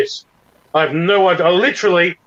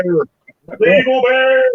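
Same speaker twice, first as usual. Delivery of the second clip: slow, high, drawn-out spooky voice; drawn-out evil bear; 11 semitones higher, much slower than usual. Retold or imitated. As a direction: stretch it out like a cartoon villain. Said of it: "the evil, the evil bear".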